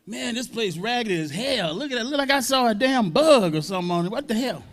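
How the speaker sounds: high, jeering tone, poking fun at a rundown club; mocking the place. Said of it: "I was making fun of the place".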